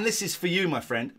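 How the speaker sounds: usual speaking voice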